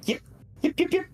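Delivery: high-pitched fox yips at the imp; fox yipping. Acted. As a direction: yap like an excited little fox. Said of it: "fox will say to you".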